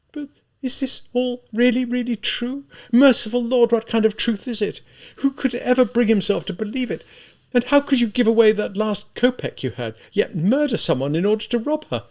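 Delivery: high, urgent voice of a horrified woman; appalled, high-pitched pleading; distraught cry. A narrator's reading. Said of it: "she cried suddenly".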